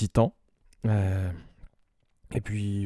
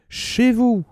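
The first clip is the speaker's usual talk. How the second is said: game-show host exclamation pitched much higher; Julien Lepers impression; 13 semitones higher than usual. Radio host mimicking a quiz-show presenter for listeners, high game-show call. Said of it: "Le mec, il imite, euh, Julien Lepers".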